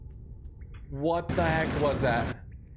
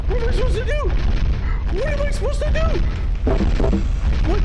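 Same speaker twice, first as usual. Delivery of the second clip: much higher, loud frantic shout; panicked yell; 10 dB louder, faster than usual. Real, not acted. Gamer cornered by moles underground, panicked yelling. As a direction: scream it in real panic.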